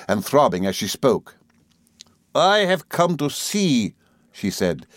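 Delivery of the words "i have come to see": high, slow, cutting contempt. A narrator's reading. Rosa come to see her rival, hatred. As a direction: draw it out with mastered rage. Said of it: "unrelenting hatred of her tone".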